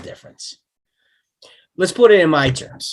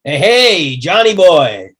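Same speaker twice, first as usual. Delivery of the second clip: loud cheery greeting; 3 semitones higher, 6 dB louder, slower than usual. Acted. Ellis greets hero over radio.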